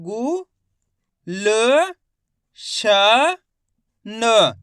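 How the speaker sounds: गु, ल, श, न each held alone with pauses; letter-by-letter sounding out. Shown as a demonstration. Teacher spells out a word for learners, isolated held syllables. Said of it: "गा का उच्चारण कैसे कर रहे हैं".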